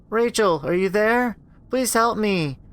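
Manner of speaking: put-on high, thin child's plea; a little sister begging her big sister; pleading little girl. Little sister begging Rachel through the door, pleading.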